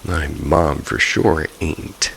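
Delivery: ordinary delivery, nothing marked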